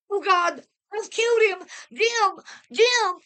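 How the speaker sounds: high screaming wail of horror; anguished scream. A narrator's reading. Wife screaming over the husband she shot, high anguished wail. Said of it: "her wild scream of agony".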